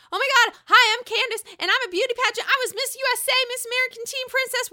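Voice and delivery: high, fast, gushing pageant-queen parody; loud mock pageant introduction; Candace. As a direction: rattle off titles like a self-important beauty queen. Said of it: "[name] comes out as Candace".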